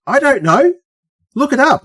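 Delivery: high-pitched, fed-up reply; squeaky mock exasperation; retold exasperation. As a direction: brush off the questioner, voice way up. His exasperated reply to questions, retold.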